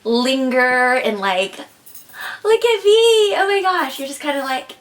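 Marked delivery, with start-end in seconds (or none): 2.4-4.0 much higher and louder, mock squealing gush; over-eager gushing. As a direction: ham up a girl begging for attention. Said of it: "Don't linger".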